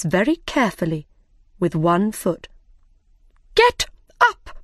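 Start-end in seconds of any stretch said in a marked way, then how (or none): 3.5-4.6 high, loud barked command; shouted order. Acted. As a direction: snap the order at the gardeners. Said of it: "the Queen shouted".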